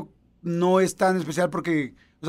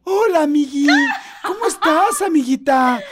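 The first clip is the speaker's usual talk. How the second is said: put-on much higher, bright gushing girlfriend voice; sugary phone greeting; 10 semitones higher, 6 dB louder than usual. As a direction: gush like she's thrilled to hear her friend. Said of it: "entra una llamada y es".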